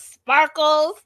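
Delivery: loud, high mocking Sparkles; Boston accent. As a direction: sing out the nickname in a jeer. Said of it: "between the Boston accent".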